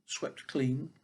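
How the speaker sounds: ordinary delivery, nothing marked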